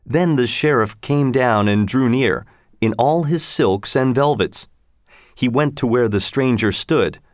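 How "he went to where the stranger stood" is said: usual speaking voice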